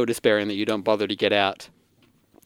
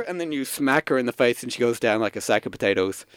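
ordinary talk, nothing marked

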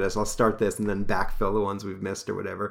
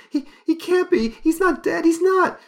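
distressed woman; 8 dB louder than usual. Acted. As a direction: shriek it like a fainting lady. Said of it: "says Lorna".

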